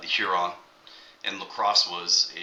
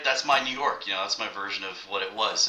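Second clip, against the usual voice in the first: normal talking voice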